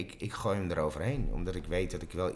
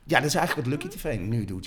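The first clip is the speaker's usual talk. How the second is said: higher and louder, eager agreement; excited echo; 7 semitones higher, 7 dB louder than usual. Real, not acted. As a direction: repeat it with a burst of excitement. Actor repeats it, excited. (enthousiast herhaald, hoger en luider)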